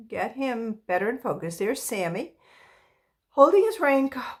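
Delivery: usual speaking voice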